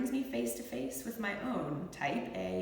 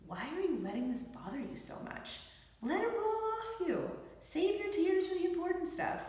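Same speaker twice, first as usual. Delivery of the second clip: high, lightly voiced dismissive relative; much higher voice, gently shrugging it off; well-meaning loved one; 11 semitones higher than usual. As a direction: brush the worry off like a kindly relative. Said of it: "heard from loved ones".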